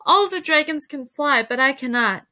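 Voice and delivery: lightly acted distress; mild sobbing lament. A narrator's reading. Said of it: "he sobbed".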